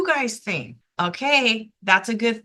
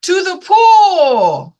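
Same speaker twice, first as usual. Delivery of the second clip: slow, loud, higher yell; 8 semitones higher, 10 dB louder, much slower than usual. Acted. Firemen answering the boy, slow loud yell. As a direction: bellow it like a fire crew. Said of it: "yelled the firemen".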